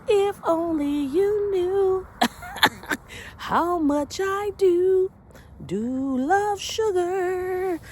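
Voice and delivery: high, drawn-out, half-sung longing; mock-wistful croon with a laugh inside; playful sugar lament. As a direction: stretch the words out high and melodic. Playful lament over loving sugar.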